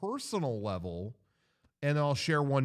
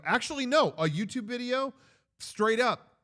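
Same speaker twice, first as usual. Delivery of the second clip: excited; 12 semitones higher, 4 dB louder than usual. Real, not acted. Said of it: "excited self-correction".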